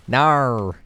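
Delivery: big Australian "Nar", blurted loud; mock Australian no. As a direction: refuse with an exaggerated Australian NAR. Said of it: "now I've been saying nar".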